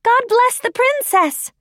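high, loud crowd cheer; ringing high-pitched acclamation; shouting. A narrator's reading. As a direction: shout it out high like cheering children lining a road. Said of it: "they shouted".

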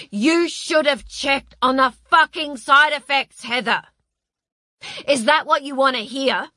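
high, loud furious shouting. Acted. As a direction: shout it at her in rage. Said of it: "shouting as loud as".

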